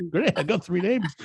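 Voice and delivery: laughing repeat. Acted.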